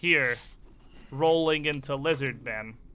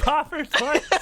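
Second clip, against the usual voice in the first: ordinary delivery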